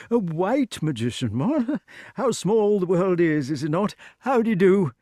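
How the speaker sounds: put-on high, nervous chatter of an old king; bumbling old knight. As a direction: babble politely, a bit rattled. Voices King Pellinore.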